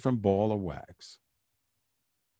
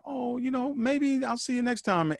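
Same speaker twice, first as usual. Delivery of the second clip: gentle goodbye; 12 semitones higher than usual. Retold or imitated. Wife's gentle goodbye to kid.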